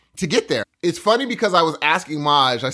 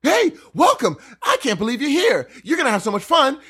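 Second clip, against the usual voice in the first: much higher, beaming welcome; genuinely happy; 9 semitones higher than usual. Retold or imitated. Ranger welcoming a driver at the gate, beaming and high. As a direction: greet them like the happiest man alive. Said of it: "He was so genuinely happy".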